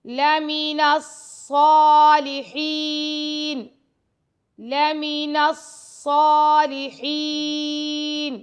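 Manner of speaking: slow melodic recitation of لَمِنَ الصَّالِحِينَ; Quran recitation. Shown as a demonstration. Teacher recites for students in slow, sung tajweed. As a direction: chant it slowly, holding the long vowels. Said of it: "teacher models verse recitation".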